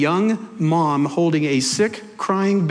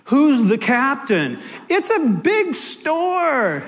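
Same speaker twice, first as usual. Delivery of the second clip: slow, higher-pitched comic pleading; mock protest; 6 semitones higher, much slower than usual. Arguing with the voice in his head, drawn-out mock protest. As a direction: drag it out in exasperated protest.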